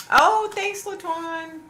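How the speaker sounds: very high, loud, bright-eyed thank you; squealed thanks. Real, not acted. Streamer squealing thanks to a viewer for a comment. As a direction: squeal your thanks to a friend.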